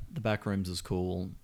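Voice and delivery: normal talking voice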